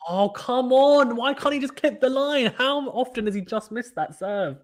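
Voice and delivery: real exasperation at the player; exasperated groan. Real, not acted. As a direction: pitch jumps up in disbelief. Exasperated at missed serve.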